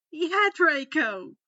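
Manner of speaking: loud, slow cheer; egging on. Acted. Friend cheering Draco on, loud and slow. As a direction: cheer him on loudly.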